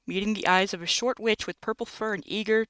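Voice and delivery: usual speaking voice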